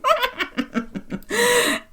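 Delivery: high, soft run of giggles; tipsy giggle. Acted. Psychic getting tipsy on her third glass, giggling. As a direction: giggle away at your own joke, high and light. Tipsy medium laughs at herself.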